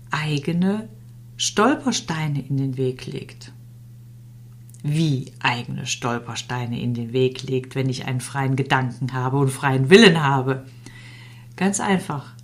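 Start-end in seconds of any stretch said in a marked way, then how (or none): none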